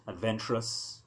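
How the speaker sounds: plain reading voice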